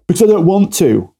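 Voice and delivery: put-on high, whiny child pushing back; vulnerable child. Demoing the child answering the parent, high and whiny. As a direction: sulk back like a hurt child. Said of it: "vulnerable child".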